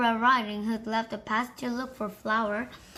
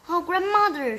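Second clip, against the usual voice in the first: amazed; 7 semitones higher, 5 dB louder than usual. Acted. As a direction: marvel at grandmother's ears. Wondering at big eyes.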